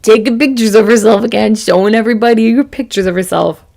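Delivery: loud, exaggerated mock disapproval; mocking critic. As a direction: mimic someone scoffing at a show-off. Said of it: "I don't want people to think".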